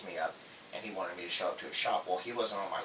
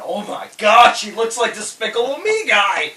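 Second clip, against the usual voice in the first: high, loud excited exclamation; crying out in comic astonishment; excited boss; 12 semitones higher, 14 dB louder than usual. Retold or imitated. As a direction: shout it in amazed recognition. Retelling boss's amazed phone call.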